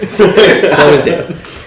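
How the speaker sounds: slow, loud laughing remark. Real, not acted. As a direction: laugh it out, drawn out. GM laughs, drawn out.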